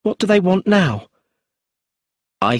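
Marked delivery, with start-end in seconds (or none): 0.0-1.1 higher, anxious and louder; nervous. A narrator's reading. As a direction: fret about the police out loud. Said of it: "said Brenda nervously".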